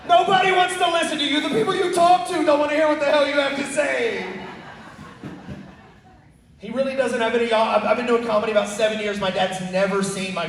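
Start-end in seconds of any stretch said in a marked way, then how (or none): 0.1-4.2 mock yell. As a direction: shout him down with a grin. Son yelling at dad.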